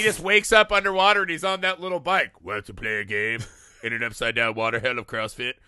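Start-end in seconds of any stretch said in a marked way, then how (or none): 2.4-5.5 mock horror-movie villain; Jigsaw voice. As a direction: rasp it like the Saw villain, lightly. Jigsaw voice from Saw.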